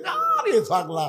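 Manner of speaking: high, drawn-out exclamation; slow high mocking call; scoffing exclamation. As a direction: scoff with a stretched high first word. Mocking friend's reply, acted.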